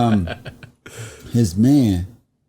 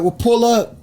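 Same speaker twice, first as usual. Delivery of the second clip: high eager invitation; lifted excited offer; 10 semitones higher than usual. Retold or imitated. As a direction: toss it off high and thrilled. Retelling invite from idol.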